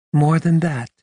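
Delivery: high, incredulous echo; surprised. A narrator's reading. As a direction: repeat it in disbelief. Carmen echoes surprised.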